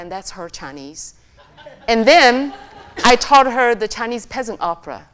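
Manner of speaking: ordinary delivery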